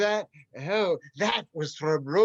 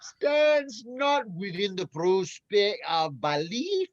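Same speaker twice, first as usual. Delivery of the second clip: bad Scottish accent. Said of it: "it's a Scottish accent".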